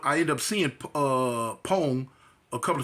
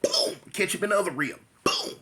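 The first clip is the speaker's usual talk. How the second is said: ordinary talk, nothing marked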